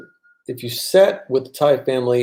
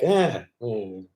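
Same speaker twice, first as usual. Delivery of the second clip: lazy unbothered reply; 5 semitones lower, 6 dB quieter than usual. Retold or imitated. Relaxed Thais shrugging off the rushed foreigner, low and lazy. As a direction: play it totally unbothered. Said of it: "They're like".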